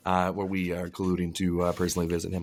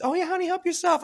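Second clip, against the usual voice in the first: much higher, sugary nurse; sweet nurse voice. Retold or imitated. Nurse telling the sweaty patient to take the towel, high and sweet. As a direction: coo it like a kind nurse.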